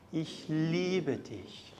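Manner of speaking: soft, very slow and tender; tender, slow German love line; sweet melodious tones. Shown as a demonstration. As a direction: breathe it lovingly, slowly. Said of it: "in our best pronunciation and sweetest melodious tones".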